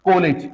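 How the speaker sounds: full "o" in kolej; college as kolej. Shown as a demonstration. Teacher repeating second spelling option, "o" in kolej. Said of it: "दो रास्ते हैं".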